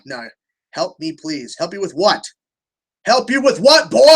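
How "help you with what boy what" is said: louder, higher exasperated call; annoyed shout. Real, not acted. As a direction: raise your voice, exasperated.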